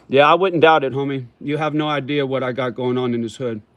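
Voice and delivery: normal talking voice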